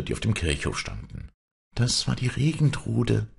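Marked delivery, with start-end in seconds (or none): none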